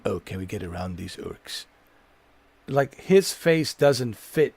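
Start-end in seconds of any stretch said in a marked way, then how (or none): 0.0-1.7 low, soft mimicry of a game character; soft-spoken game character. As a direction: imitate his low, quiet voice. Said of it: "the way this guy talks".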